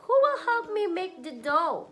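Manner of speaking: high, loud pleading question; hen asking loudly. Acted. Mother voicing the hen's plea to her son, high and loud. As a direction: call out to the animals for help.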